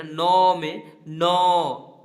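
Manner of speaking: नौ drawn out very slowly and loudly; teacher stretching नौ for the class; stretched-out demo. Shown as a demonstration. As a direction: hold नौ long and loud to show its vowel. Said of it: "नौ में अ का sound आ रहा है".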